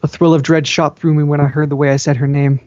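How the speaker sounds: usual speaking voice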